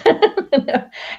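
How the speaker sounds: high, loud laugh near tears; tearful laugh. Real, not acted. Host overcome by her guest's kind words, laughing. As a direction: let a teary laugh burst out. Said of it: "make me cry again".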